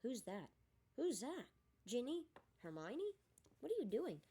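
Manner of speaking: rising alarmed questions in the dark; startled questions. Acted. Boy in a dark train, startled and alarmed.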